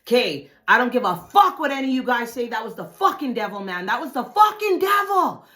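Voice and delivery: high, loud, building to a shout; emphatic insisting. Retold or imitated. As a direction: insist harder until you are shouting. Re-enacts insisting after the fight.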